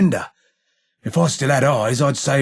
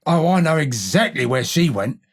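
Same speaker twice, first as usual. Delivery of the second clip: normal talking voice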